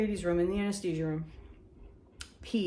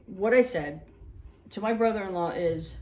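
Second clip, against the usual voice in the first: ordinary talk, nothing marked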